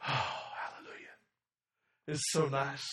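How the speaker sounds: hushed, breathy whispered sigh of relief. Retold or imitated. Parent enjoying the quiet house, whispering. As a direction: whisper it like the house is finally asleep.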